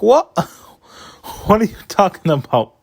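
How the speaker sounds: high, laughing disbelief at a silly question; disbelieving. Retold or imitated. Vlogger retelling his reaction to the journalist's question, with high laughing disbelief.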